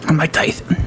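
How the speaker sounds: lisping boxer impression; Mike Tyson. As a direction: introduce yourself as the lisping champ. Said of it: "I can do a good Mike Tyson".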